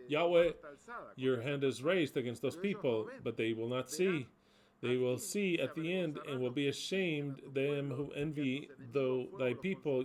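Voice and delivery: loud, ringing pulpit voice, calling out "Yahweh"; fervent scripture reading. Acted. Preacher reading scripture aloud to the congregation, loud and fervent. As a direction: proclaim it like a preacher at full force.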